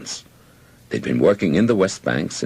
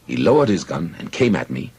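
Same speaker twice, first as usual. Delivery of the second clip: ordinary delivery, nothing marked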